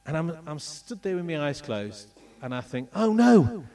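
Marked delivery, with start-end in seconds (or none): 3.0-3.6 mock panic. Panicked thought about neck exploding.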